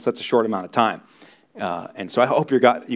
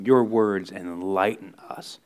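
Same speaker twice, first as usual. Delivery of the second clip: soft, lower prayer voice; closing prayer; 4 semitones lower, 3 dB quieter than usual. Real, not acted. Pastor praying over the congregation, soft and lower. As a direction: pray it gently over the room.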